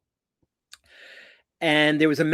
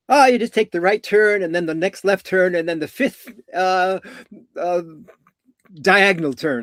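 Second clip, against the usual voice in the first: raised, louder rattled-off directions, stumbling at the end; hurried, overloaded route-giving; quick directions; 5 semitones higher, 5 dB louder than usual. Shown as a demonstration. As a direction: reel off directions as if in a hurry. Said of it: "If you gave it to me very quickly".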